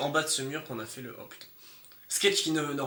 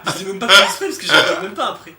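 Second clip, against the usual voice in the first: laughing; 3 semitones higher, 13 dB louder than usual. Real, not acted. Still laughing at clip.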